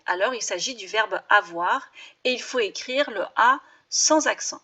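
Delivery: usual speaking voice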